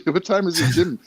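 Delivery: a mother ready to march in; demanding mom. Retold or imitated. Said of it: "she's like".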